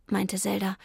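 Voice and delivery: ordinary delivery, nothing marked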